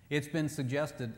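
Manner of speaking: ordinary talk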